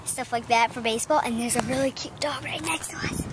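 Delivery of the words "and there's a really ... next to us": whispering. Real, not acted. Whispering about dog nearby.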